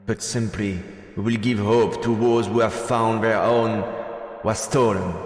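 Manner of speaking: loud, ringing speech to a crowd; rallying speech. Acted. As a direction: address the cheering crowd like a rebel leader. Rebel leader's rally speech.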